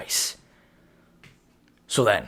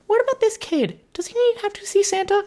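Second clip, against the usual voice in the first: mock high voice. Voicing a character in the retelling.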